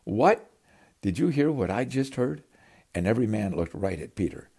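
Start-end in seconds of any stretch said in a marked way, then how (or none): 0.0-2.4 astonished. Acted. Disciples stunned by Peter's request.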